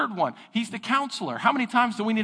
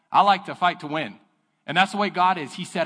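normal talking voice

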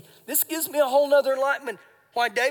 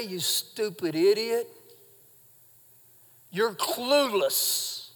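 slow, sneering insult, softer; mock contempt; 5 semitones lower, 6 dB quieter, much slower than usual. Preacher voicing the insult David never spoke, scornful and slow. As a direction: drag out the put-down with scorn. What David did not say.

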